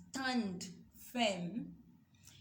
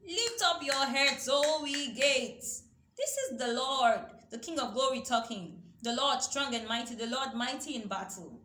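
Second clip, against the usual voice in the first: proclaiming King of Glory; 4 semitones higher, 6 dB louder than usual. Acted. Preacher voicing the King of Glory.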